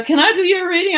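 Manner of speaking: high, loud sing-song pitch; card reader pressing a stranger, high and loud; pushy reader. Retold or imitated. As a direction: sell the reading brightly.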